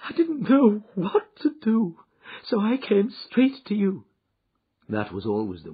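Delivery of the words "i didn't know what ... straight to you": high, loud voice of a frightened wife; distraught lady. Acted. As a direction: pour it out helplessly. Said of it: "distraught lady speaks".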